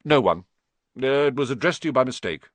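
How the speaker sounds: hurried, curt denial; said shortly. A narrator's reading. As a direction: brush the question off curtly. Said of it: "said Uncle Vernon shortly".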